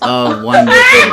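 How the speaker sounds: high, laughing oh wonderful; delighted laugh through the words. Real, not acted. Host laughing at the haunted house birthday plan, delighted.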